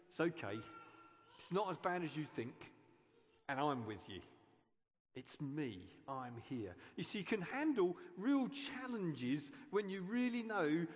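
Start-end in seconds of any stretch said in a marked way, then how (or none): 0.1-6.7 gentle reassuring Jesus. Acted. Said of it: "we need to hear him just say".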